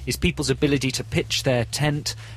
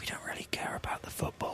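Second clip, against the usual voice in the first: whispered aside; 15 dB quieter than usual. Acted. Conspiratorial aside about football.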